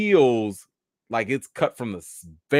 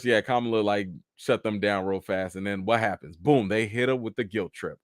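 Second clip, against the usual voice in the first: ordinary delivery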